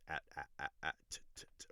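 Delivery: "at" repeated, then just a voiceless "t"; isolated rhyme sounds. Shown as a demonstration. Teacher isolating what listeners notice, "at" shrinking to "t". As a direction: strip "at" down to a tapped "t". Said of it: "you're paying attention to those".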